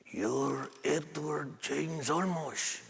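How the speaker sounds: put-on a quiet elderly stranger, very slow; old man. Old man in the restroom hall names Olmos, slow and soft. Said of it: "about eighty-two, eighty-three years old".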